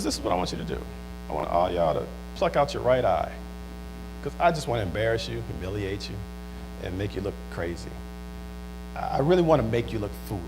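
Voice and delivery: very low, soft enemy voice; menacing besieger. Acted.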